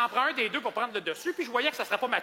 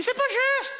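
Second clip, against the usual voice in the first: high, loud whiny cry; shrill complaining lady; 5 dB louder, slower than usual. Retold or imitated. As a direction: squeal it like an offended customer. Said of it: "il y en a une qui me crie". (cliente qui crie d'une voix aiguë et geignarde)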